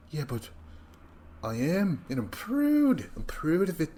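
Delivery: high-pitched, badly faked accent impression; terrible Kyle accent. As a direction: put on a bad accent, proud and high. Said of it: "That's a terrible accent".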